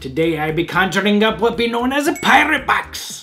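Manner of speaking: put-on high, loud swashbuckling pirate accent. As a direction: ham it up like a pirate captain. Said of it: "Why ye be talking like a scurvy pirate?".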